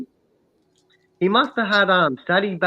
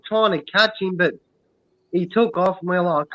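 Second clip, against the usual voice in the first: ordinary delivery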